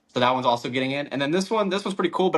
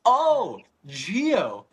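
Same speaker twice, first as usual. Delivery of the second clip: sudden realization; 7 semitones higher than usual. Retold or imitated. Retelling the friend's sudden realization, a surprised oh. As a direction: let it click all at once.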